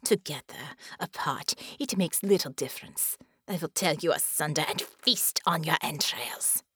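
low, menacing alien warlord; contemptuous monster voice; spat villain threat. A narrator's reading. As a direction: spit out the threat as a haughty villain. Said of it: "Baron spat".

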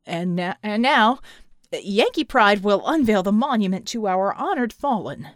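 loud, halting ceremonial proclamation; public announcement. Acted. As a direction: announce it to a whole crowd. Verdigris makes speech announcement to crowd.

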